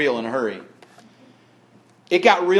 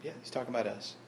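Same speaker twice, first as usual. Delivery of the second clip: muttering Pharisee; 3 semitones lower, 19 dB quieter, faster than usual. Acted. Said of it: "The Pharisee's going".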